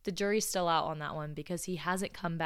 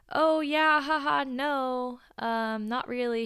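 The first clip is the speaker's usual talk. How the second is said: high, loud nervous-laugh deflection; raised pitch, drawn-out reluctant no; awkward polite brush-off; 7 semitones higher, 6 dB louder, slower than usual. Retold or imitated. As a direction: imitate a coworker awkwardly deflecting. Coworker brushing off marriage question.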